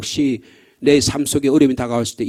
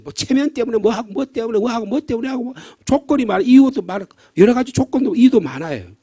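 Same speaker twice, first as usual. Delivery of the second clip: fervent preaching; 7 semitones higher than usual. Acted. Preacher rising to emphatic fervor.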